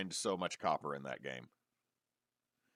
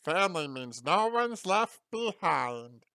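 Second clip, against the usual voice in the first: high, slow, rough cartoon alien voice; Stitch voice; 7 semitones higher, 7 dB louder than usual. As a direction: rasp it out like Stitch. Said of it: "the Stitch voice can also be the Golem voice".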